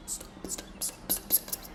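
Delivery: barely audible repeated plea; urgent whisper. Retold or imitated. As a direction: whisper frantically so nobody else hears. Hissed at cat while people watched.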